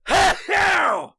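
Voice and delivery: loud, high laugh at himself; burst of laughter. Real, not acted. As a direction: crack up at the sneeze. Laugh after a sneeze.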